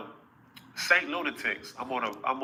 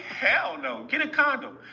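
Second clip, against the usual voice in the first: higher-pitched woman's firm no; 13 semitones higher, slower than usual. Retold or imitated. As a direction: voice her insisting on a condom. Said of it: "she was like".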